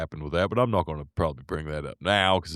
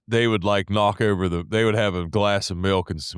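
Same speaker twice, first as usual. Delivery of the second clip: usual speaking voice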